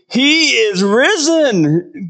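high, slow, loud proclamation; exclaimed loudly. Shown as a demonstration. Pastor showing how the angel's news should sound, exclaimed loudly. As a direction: shout it out slowly so nobody misses it. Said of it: "meant to be exclaimed loudly".

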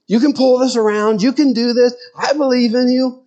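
high, eager coaching cheer; grandfather cheering the TV. Retold or imitated. Grandfather urging his football team on through the television, eager high cheer. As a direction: pump up a losing team from the couch. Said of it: "talk to the television in such a way to encourage".

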